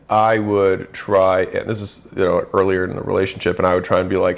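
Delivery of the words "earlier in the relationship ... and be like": ordinary talk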